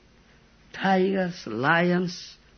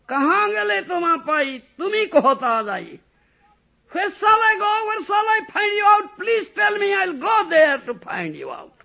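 very high, loud crying shout; desperate shout; 7 dB louder than usual. Acted. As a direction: call out frantically for the lost beloved. Mahaprabhu searching desperately for Krishna.

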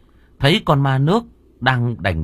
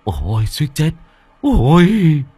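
panting gasp of relief, louder at the end; breathless relief; 6 semitones higher, 5 dB louder, slower than usual. A narrator's reading. Two old men just escaped, panting with relief. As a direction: gasp out the near-miss.

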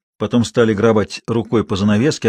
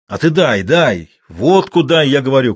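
suffering shout; 4 semitones higher, 5 dB louder than usual. A narrator's reading. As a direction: plead loudly, suffering. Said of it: "крикнул старик страдальчески". (страдальчески крича)

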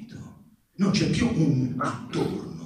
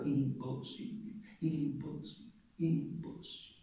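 soft, spelling out impossibile syllable by syllable like a first-grader; lightly voiced schoolchild reading aloud; syllabling like a child; 11 dB quieter than usual. A narrator's reading. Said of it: "sillabando come un bambino di prima elementare".

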